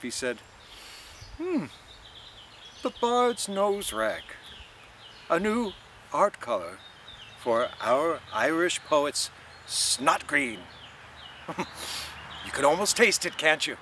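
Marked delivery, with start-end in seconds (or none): 1.4-10.6 higher, slow, savoured mockery; mocking Mulligan. A narrator's reading. Mulligan mocking Stephen's dirty handkerchief, slow and savouring. 11.2-12.4 soft unvoiced laugh; a quiet snigger at his own joke; breathy chuckle. A narrator's reading. Mulligan sniggering at his own joke, soft laugh. 12.5-13.8 higher, louder playful dare; goading Mulligan. A narrator's reading. Mulligan goading Stephen to taste it, playful.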